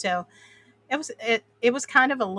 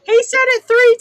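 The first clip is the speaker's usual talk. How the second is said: laughing; 14 dB louder than usual. Real, not acted. Laughing at the chat message.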